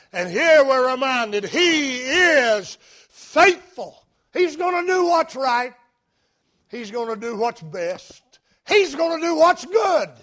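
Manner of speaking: loud, high-pitched pulpit preaching; fervent preaching. Acted. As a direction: drive each phrase home like a revival preacher. Preaching at full force.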